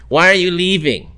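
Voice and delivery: mild mock accusation; imitated pushy woman. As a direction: challenge him like a pushy bar-goer. Imitating woman confronting him at bar.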